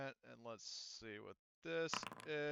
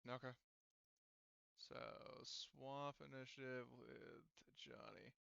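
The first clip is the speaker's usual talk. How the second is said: ordinary talk, nothing marked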